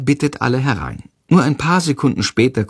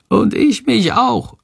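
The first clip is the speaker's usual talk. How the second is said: very high, slow little creature voice; tiny high-pitched secretive agreement; small whispering creature; 12 semitones higher, slower than usual. A narrator's reading. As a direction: squeak it slowly, as a voiced whisper. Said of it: "flüstert leise Shandor".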